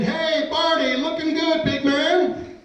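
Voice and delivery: high-pitched hail to a pal; buddies' hearty greeting. Retold or imitated. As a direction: greet him like cheerful bar buddies. Buddies greet him as he walks in.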